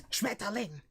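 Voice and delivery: hammed-up angry German word; mock angry. Shown as a demonstration. Showing viewers how German gets caricatured, mock angry. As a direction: bite the word out, mock furious. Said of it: "when you talk like this".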